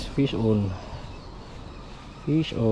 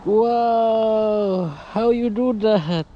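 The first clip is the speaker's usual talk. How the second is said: excited cheering; 11 semitones higher, much slower than usual. Real, not acted. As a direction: cheer him on with big wows. Cheering a friend's catch.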